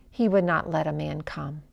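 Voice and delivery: usual speaking voice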